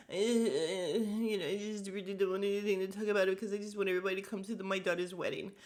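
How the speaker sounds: hesitant, then rushed mumbling imitation; scared mumble. Imitating Kyle's scared mumble when asked a question. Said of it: "her mumble thing where she's scared to talk".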